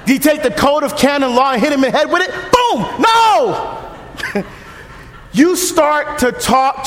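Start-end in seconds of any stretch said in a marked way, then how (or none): 2.5-2.9 mock boom. Mock hitting sound.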